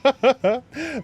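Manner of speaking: high loud laugh trailing on; laughter. Real, not acted. Streamer still laughing about the cucumber.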